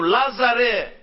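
preacher's ringing summons; calling out. Acted. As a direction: call him forth by name. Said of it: "φώναξε το Λάζαρο με το όνομά του".

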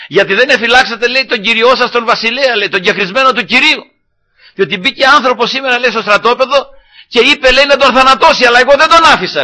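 high-pitched, insistent retold rebuke; calling out. David scolds the army for not guarding the king, calling out. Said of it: "Άρχισε να φωνάζει το στρατηγό".

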